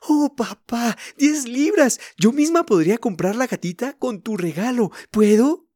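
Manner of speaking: put-on high, thrilled child voice; joyful little girl. A narrator's reading. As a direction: burst out with delighted realization. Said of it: "con alegría".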